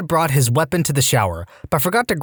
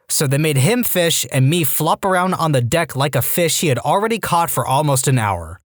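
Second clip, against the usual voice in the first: plain reading voice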